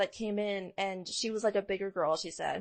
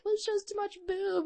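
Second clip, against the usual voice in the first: lightly voiced saleswoman; 12 semitones higher than usual. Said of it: "And be like".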